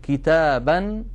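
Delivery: كتابا said slowly as "kitaban", nun clearly sounded; slow "kitaban" with clear nunation; 1 nunation demo. Shown as a demonstration. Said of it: "يعني نقول".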